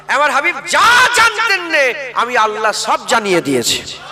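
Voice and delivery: full-force preacher's shout; preaching shout. Acted. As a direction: cry it out to the whole crowd. Sermon climax, shouting to crowd. (জোরালো ওয়াজের চিৎকার)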